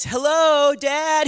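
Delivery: put-on high, loud squeaky kid voice; ringtone child voice. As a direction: chirp it like a child's recording. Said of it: "you hear this voice".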